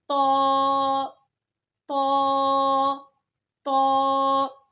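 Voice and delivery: "तो" said thick and full, loud; heavy to. Shown as a demonstration. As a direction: make "तो" heavy. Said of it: "इसको सिर्फ मोटा करना है". ("तो" मोटा करके)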